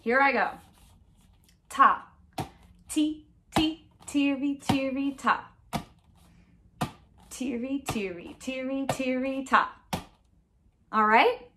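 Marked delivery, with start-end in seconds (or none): 1.7-9.7 mixed rhythm syllables chanted on an even pulse; steady beat. Shown as a demonstration. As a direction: chant the pattern in strict time. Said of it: "keep a steady beat".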